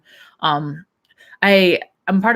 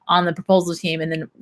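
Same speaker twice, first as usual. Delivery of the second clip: ordinary talk, nothing marked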